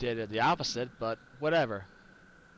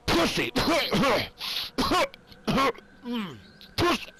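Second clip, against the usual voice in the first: loud, high word burst out in a fake cough; 9 semitones higher, 5 dB louder than usual. Joking with the listener about a choice, word disguised as coughing. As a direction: cough the insult out as if clearing the throat. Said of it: "Had something in my throat".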